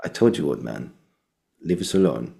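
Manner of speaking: low, soft, understated reading; underplay it quietly, pausing mid-line; taking it down. Retold or imitated. Teacher models the line for the student, low and understated. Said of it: "Just take it, take it".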